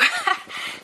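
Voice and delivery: a quick squeaky giggle; giggling while teasing; high giggle. Acted. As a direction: break into a high little laugh. Giggle mid-question.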